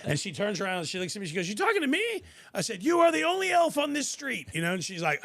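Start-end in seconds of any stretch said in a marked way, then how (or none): 1.5-2.2 high-pitched, loud mock disbelief; hammed-up incredulity, pitched way up; incredulous question. Voices the startled stranger's question. 2.8-4.5 high, loud re-enacted holler; mock-exasperated yell; shouted retort. Acts out his shouted reply.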